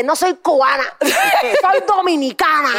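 mock-indignant, drawn out slow and high at the end; imitating an offended cousin insisting on her nationality; indignant protest. Cousin mock-protesting she's Dominican, not Cuban. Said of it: "unas encabronadas de".

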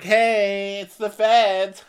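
hammed-up police-raid bark; mock announcing the feds. Host imitating Heather joking at the door, high and loud.

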